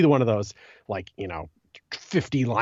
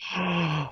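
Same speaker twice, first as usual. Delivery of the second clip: a quick sharp intake of breath; surprised gasp; 6 semitones higher, 5 dB quieter than usual. Real, not acted. Cohost learning enhansives can be hidden, surprised gasp. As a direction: gasp at the news.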